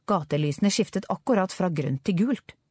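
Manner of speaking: plain reading voice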